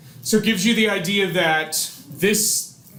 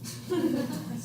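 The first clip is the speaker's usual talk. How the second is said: soft breathy laugh; 10 semitones higher, 10 dB quieter than usual. Real, not acted. Laughs after whisper joke.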